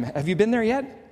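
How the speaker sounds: ordinary delivery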